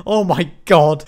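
ordinary delivery

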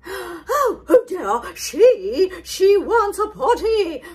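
high, loud, laughing flustered cry; flustered cook. Acted. The cook joining the panic, loud and laughing. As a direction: blurt it through a laugh. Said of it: "cried the cook".

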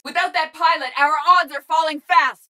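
high, pressing warning; urgent combat shout. Acted. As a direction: call out over battle that things are going badly.

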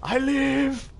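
high mock voice. Revived, mock exclaiming.

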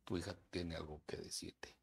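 hushed, awkward announcement to the mother; embarrassed whisper. Retold or imitated. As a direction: whisper it with shame. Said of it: "con mucha pena".

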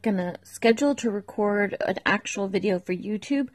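ordinary talk, nothing marked

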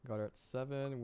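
ordinary talk

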